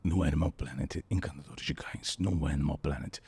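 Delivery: low breathy channel promo. Retold or imitated. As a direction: intimate documentary promo. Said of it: "eu queria mais ou menos assim". (chamada grave e sussurrada)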